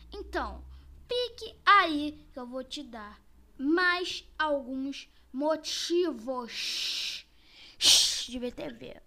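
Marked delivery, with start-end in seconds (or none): none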